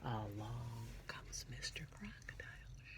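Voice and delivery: whispered, sneaking; suspense whisper. Acted. Teacher reading to children as the crocodile creeps up, whispered.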